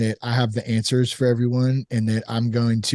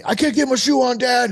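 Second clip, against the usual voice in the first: put-on high-pitched, exasperated child's whine; mock frustrated complaint, pitched up high; imitating frustrated kid; 9 semitones higher than usual. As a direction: act out a kid fed up with Dad. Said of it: "he's getting pissed off".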